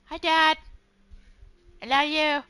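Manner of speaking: sweet talk to Dad in a put-on voice; high cutesy kid voice greeting Dad. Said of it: "when I talk in that voice".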